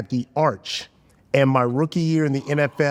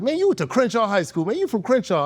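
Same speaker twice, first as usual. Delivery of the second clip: dismissive acquaintance; 7 semitones higher than usual. Retold or imitated.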